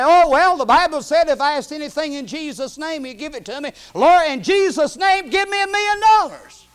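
hammed-up high, loud plea to the Lord; demanding prayer. Preacher acting a person claiming a million dollars, mock demanding prayer. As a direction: cry out a mock prayer at full voice. Acts out a presumptuous praying person.

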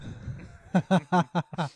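loud laugh. Real, not acted. Laughing at the joke tweet.

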